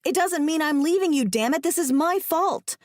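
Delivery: high, upset and insistent; distressed outburst slowing at the end; snapping. A narrator's reading. Woman blaming herself to trapped man, snapping. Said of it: "I snap".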